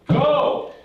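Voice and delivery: sudden furious shout of go; God loses patience and yells it; angry shout. Acted. God yelling at reluctant Moses, furious shout. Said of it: "God gets really angry".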